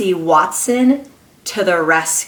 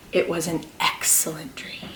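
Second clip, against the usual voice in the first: whisper; 3 semitones lower, 9 dB quieter than usual. Acted. Dreamy read-aloud line.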